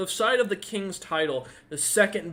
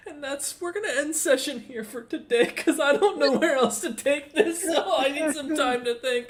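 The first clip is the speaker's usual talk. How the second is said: laughing through the sign-off, higher and louder; can't stop giggling as you wrap up; 11 semitones higher, 4 dB louder than usual. Real, not acted. GM laughs, ending the session.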